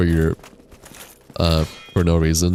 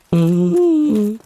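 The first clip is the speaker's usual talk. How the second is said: voice crack; 4 dB louder than usual. Real, not acted. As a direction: voice cracking up high. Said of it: "except for when my voice cracks".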